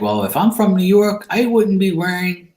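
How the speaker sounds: mock-indignant comeback; protesting. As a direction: argue the obvious, pitch lifted. Retold protest at wrong guess.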